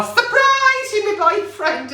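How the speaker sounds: high squeal on Surprise, then bright reveal; spring it on him like a gift; excited surprise. Pretend. Imagining telling the Tesco crush, a high excited reveal. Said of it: "one day I will go".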